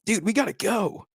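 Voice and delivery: hammed-up panicked friend; mimicking a frantic bro; impression of Steven. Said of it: "I'm trying to do an impression of him".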